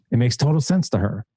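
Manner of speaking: normal talking voice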